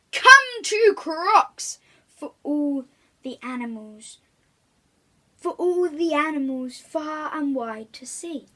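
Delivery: slow, loud announcer voice; crocodile's banner invites all the animals; grand announcement. Acted. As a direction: proclaim it like a banner ad.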